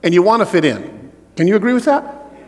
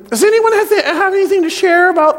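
high put-on teacher voice, much higher; teacher Missus Graham; 10 semitones higher than usual. Teacher asks the class to share, high prim voice. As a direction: play the prim schoolteacher asking the class. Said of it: "she says".

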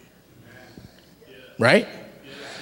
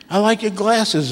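imitating an admirer, pitched up; lightly acted higher-voiced flattery; gushing compliment; 6 semitones higher than usual. Preacher acting out someone praising glasses, high and complimentary. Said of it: "they start complimenting you".

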